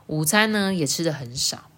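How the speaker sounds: usual speaking voice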